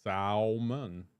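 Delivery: slow, drawn-out "salmon" with the L sounded; low, deliberate salmon with audible L; salmon as spelled. Shown as a demonstration. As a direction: stretch salmon into SAL-mun, every syllable. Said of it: "I pronounce it how it's spelled".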